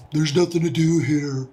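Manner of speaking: put-on bored mopey teen whine; dumb teenager voice. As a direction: lightly play a whiny teen. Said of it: "my, uh, dumb teenager voice".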